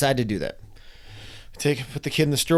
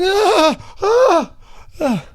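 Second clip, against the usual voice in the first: high, loud frozen screams; reenacted screams of fear; terrified scream; 5 dB louder, much slower than usual. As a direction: scream like you're stuck mid-fall. Frozen in the air mid-jump.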